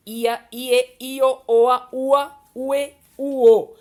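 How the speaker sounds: each ending called out loud and high; spelled-out vowel endings. Shown as a demonstration. Teacher calling out each diphthong ending loud to her students. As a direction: read each ending out slowly for the class. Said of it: "na, eu".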